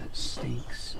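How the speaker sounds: disgusted whisper; smelling something awful. Real, not acted. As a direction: breathe it out, repulsed by the stench. Recoiling from smelly car.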